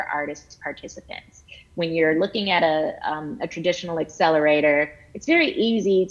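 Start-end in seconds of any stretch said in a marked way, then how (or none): none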